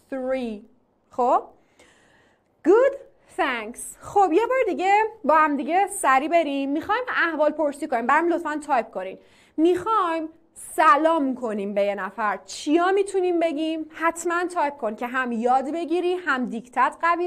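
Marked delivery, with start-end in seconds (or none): none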